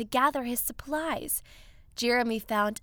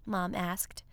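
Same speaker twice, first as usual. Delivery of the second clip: plain reading voice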